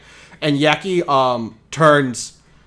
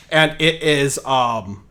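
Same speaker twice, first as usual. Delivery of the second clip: usual speaking voice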